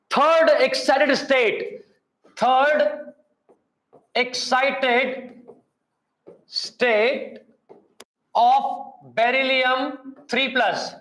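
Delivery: slow dictation, long pauses between words. Real, not acted. Teacher giving students a ratio question, word-by-word dictation. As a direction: dictate slowly, a word at a time.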